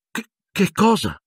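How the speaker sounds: high stammering disbelief; shocked exclamation. A narrator's reading. Harry leaping up as McGonagall takes his broom, shocked. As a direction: jump up stunned. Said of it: "esclamò Harry balzando in piedi".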